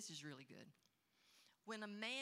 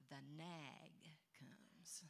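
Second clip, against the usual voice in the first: ominous hush; 3 semitones lower, 7 dB quieter, much slower than usual. Pretend. Spooky announcement of the nag.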